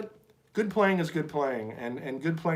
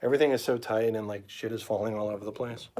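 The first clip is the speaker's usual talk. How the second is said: ordinary delivery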